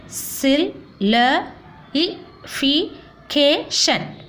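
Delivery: very slow, a gap after every syllable; teacher counting out syllables by voice. Shown as a demonstration. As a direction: sound out the long word in separate pieces. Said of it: "words having more than five syllable".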